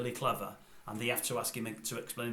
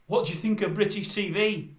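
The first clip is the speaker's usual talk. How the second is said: high, loud mock presenter question; hammed-up interviewer voice; imitated TV presenter; 8 semitones higher, 7 dB louder than usual. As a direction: put it brashly, mimicking the host. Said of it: "he says to her".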